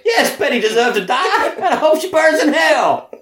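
high laughing Frank voice; mock Frank Spencer rant. Twisting the sitcom into a dark joke, high laughing whine. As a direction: gleefully rant in his whine.

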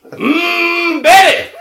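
high loud squeal of Betty; Frank Spencer wail. Retold or imitated. Joining the Frank Spencer riff, high loud wail.

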